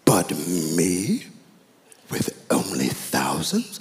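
slow British indignation, dropping low on "but me"; hammed-up British accent, rising in disbelief. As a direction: put on an offended British royal. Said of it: "he has a British accent".

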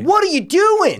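high, loud, drawn-out protest; exasperated outburst. Acted. Acted exasperation at the film.

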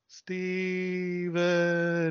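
drawn-out, haunting whisper of a name from a tape; eerie ghost voice. Retold or imitated. Eerie tape voice calling his brother's name, haunting and drawn out. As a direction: call the name like a ghost in the silence.

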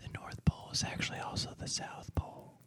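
hushed conspiratorial whisper. Acted. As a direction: whisper it like a secret. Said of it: "Why are you whispering?".

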